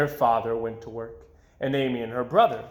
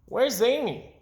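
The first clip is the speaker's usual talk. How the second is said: brother voice; 10 semitones higher than usual. A narrator's reading. Brother's voice in story.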